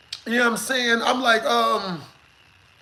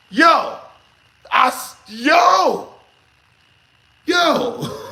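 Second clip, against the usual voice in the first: high, slow, stuttering "yo"; stammering, flustered yells; 6 semitones higher, 5 dB louder, much slower than usual. Retold or imitated. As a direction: caught off guard, stammer it out. Flustered reaction to threat.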